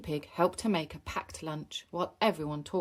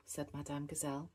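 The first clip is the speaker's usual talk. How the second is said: ordinary delivery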